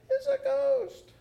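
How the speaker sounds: high, drawn-out frightened cry; terrified cry. Acted. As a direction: cry out as if you've seen a ghost. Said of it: "they were terrified and said".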